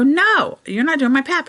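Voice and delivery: firm refusal. Retold or imitated. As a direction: shut the offer down hard. Refusing the doctor's repeated offer.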